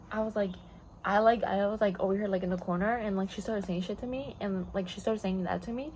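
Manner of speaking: ordinary talk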